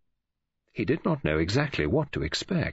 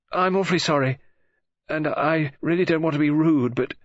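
higher, flustered apology; apologetic girl; 5 semitones higher, 4 dB louder than usual. Acted. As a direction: apologise in mounting agitation.

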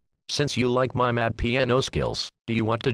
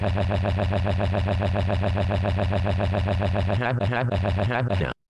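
long, droning stuttered noise; monotonous machine-gun "ha-ha-ha"; imitated noise; 3 semitones lower than usual. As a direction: rattle off one syllable mechanically, on and on. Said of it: "fucked my piano like".

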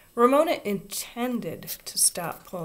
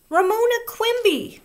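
high, loud outraged shriek; 9 dB louder than usual. A narrator's reading. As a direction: scream her full name in outrage. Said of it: "shrieked Susan".

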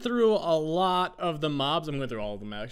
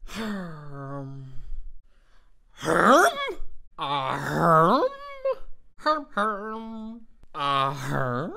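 questioning villager grunt; 4 dB louder, much slower than usual. Acted. Two yeses for the villager.

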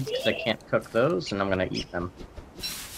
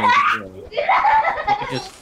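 squeaky laughing; 6 dB louder, much slower than usual. Real, not acted. Squeaky pitch leap.

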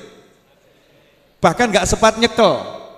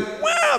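high imitated child's yell; child's shriek; 3 dB quieter than usual. Preacher imitating a child running around during prayer, high shriek. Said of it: "teriak-teriak".

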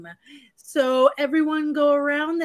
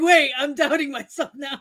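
laughing self-doubt; 2 semitones higher than usual. Retold or imitated. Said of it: "I was like".

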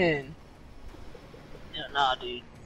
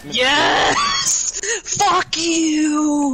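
excited shout; 7 semitones higher, much slower than usual. Real, not acted. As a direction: scream with joy at the kill. Cheering a teammate's kill.

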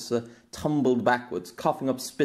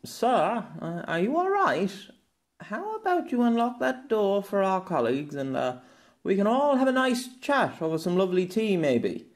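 higher, coaxing policeman voice; hesitant plainclothes cop, pitched higher; nervous officer; 8 semitones higher than usual. Acted. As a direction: talk him down gently, pitched up. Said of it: "said one of the plainclothes officers".

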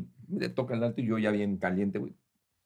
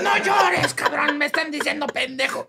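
put-on high laughing yell at a crying child; laughing mock yell; 13 semitones higher, 3 dB louder, slower than usual. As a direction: yell through laughter at the kid. Yelling at crying son, laughing. (gritándole al niño entre risas)

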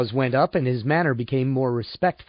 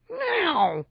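very high, drawn-out meowed word; emphatic cat meow; 4 dB quieter than usual. A narrator's reading. Cat answers his human companion, emphatic meow. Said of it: "Pete answered emphatically".

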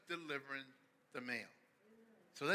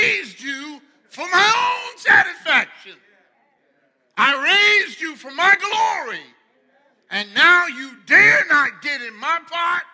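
hammed-up angry shout, much louder; mock angry owner; 12 semitones higher, 23 dB louder than usual. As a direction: bellow at the stubborn chicken. Owner yells at chicken.

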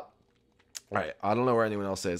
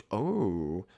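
ordinary talk

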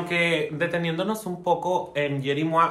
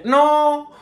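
sharp high shout of no; loud no; 10 semitones higher, 8 dB louder than usual. Real, not acted. Mocking rant.

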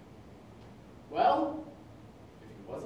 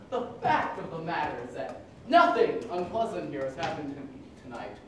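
ordinary talk

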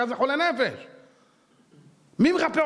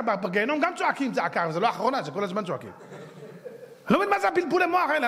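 ordinary talk

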